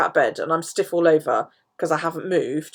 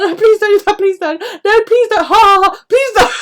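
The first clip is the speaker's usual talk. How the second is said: much higher, louder squealing plea; laughing pleading; 13 semitones higher, 9 dB louder than usual. Retold or imitated. Begging the physio to stop, laughing plea. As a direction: beg through giggles. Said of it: "And I'm like".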